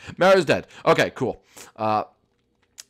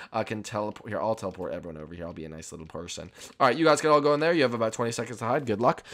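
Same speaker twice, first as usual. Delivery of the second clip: usual speaking voice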